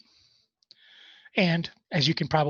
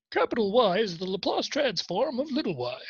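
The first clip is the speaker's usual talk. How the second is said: mock posh accent; 5 semitones higher than usual. Shown as a demonstration. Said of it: "you have to use that, uh, accent too".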